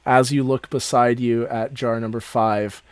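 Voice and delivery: ordinary talk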